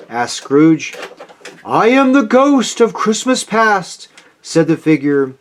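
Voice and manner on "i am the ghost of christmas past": high, ghostly announcement; otherworldly ghost introducing itself; mysterious spirit. A narrator's reading.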